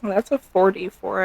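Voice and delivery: ordinary talk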